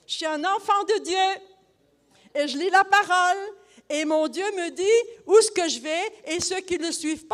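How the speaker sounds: booming witness to the astrologer; fervent preaching. Retold or imitated. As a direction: preach it at her with full force.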